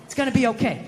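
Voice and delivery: put-on fast little-girl soothing; daughter voice. Daughter soothing dad, hand on his shoulder. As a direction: comfort dad like a child would. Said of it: "She was just going like".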